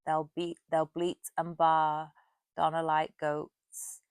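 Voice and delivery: loud, slow, measured verse reading; poem recitation. Acted. Vlogger reading the poem A Parody to viewers, slow declaimed recitation. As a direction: declaim the verse with weight on each line.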